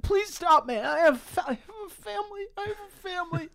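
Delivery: very high, pleading mock wail; hammed-up sobbing. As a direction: beg for mercy in squealing fake tears. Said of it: "he's crying, he's sobbing".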